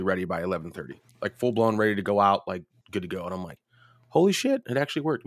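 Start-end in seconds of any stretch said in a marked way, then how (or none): 4.1-5.3 surprised. Retold or imitated.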